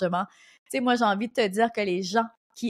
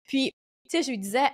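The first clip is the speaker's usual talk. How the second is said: ordinary talk, nothing marked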